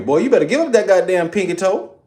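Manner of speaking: high, scolding mother's voice, well up in pitch; mama chewing out her son over a pinky toe. Retold or imitated. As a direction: play an outraged mom telling her son off. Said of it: "my mama might tell me".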